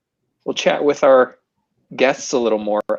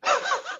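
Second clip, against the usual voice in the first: high burst of laughter; 7 dB quieter than usual. Real, not acted. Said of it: "she doesn't realize".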